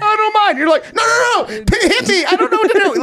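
high, pleading voice of a flinching victim; mock meek squeak. Guest mocking host as a flinching victim, high and pleading. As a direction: squeak it out like a beaten dog. Acting nice stepdad and beaten dog.

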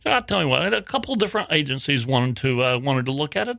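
high, lilting mock Southern lady; Southern accent. Copyright office lady explains the holdup, put-on Southern accent. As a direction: amateurish Southern impression, soothing the caller. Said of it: "Southern accent".

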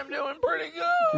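strained falsetto answer; octave too high. Acted. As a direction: pitch it way up, almost cracking. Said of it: "You went up an octave when you said that".

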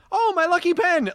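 very high, lilting Irish-tinged exclamation; slight Irish inflection. Retold or imitated. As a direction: say it high with a little Irish lilt. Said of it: "You did a little Irish inflection on that".

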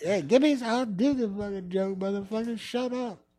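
high, rough heckled comic voice; impersonating Richard Pryor. Retold or imitated. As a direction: snap back at the crowd. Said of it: "I can remember him saying something like that".

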